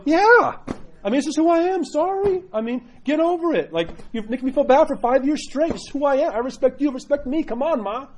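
finally fed up with the nagging; exasperated pushback. Retold or imitated. Convert pushing back at nagging mother, exasperated and high. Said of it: "Eventually you gotta go".

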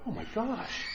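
awed hush. Retold or imitated. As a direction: murmur it in disbelief to a friend. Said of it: "I looked at Father David and I went".

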